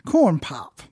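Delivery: slow Biden-style Corn Pop; Joe Biden voice. Pretend. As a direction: say it like old Joe. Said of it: "don't forget to mention corn pop".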